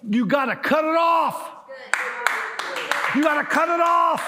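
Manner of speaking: high, loud preacher's shout; shouted exhortation. Real, not acted. Preacher urging the congregation to cut it off, shouting. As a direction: punch it out as an urgent command.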